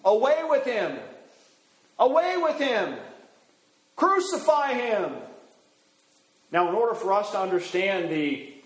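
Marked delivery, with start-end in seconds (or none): 0.1-5.2 mob shouts, pitched much higher; crowd crying out. Retold or imitated. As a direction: cry it out like a furious crowd. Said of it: "But they cried out".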